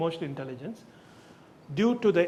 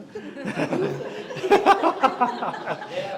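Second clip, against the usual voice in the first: a burst of real laughter, higher; 6 semitones higher than usual. Real, not acted. Apologising to the guest for using her name, laughing aloud.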